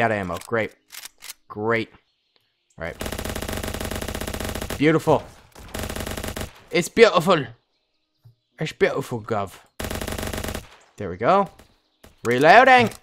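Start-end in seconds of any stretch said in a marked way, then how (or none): none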